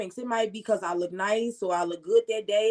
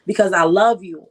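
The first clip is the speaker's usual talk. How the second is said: love bursts out loud, falling at the end; pleading outburst; 2 semitones lower, 8 dB louder than usual. Real, not acted. Woman torn about leaving blurts it out loudly. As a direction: push the words out loud and pleading.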